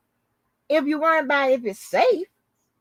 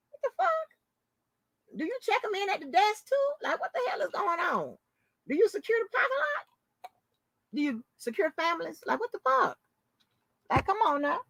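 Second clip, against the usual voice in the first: high, squeaky, softer disbelief; squealing disbelief; 9 semitones higher, 7 dB quieter than usual. Retold or imitated.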